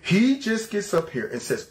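ordinary delivery

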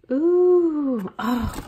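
high delighted squeal; delighted ooh. Real, not acted. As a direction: squeal at the surprise. Delighted at a gift.

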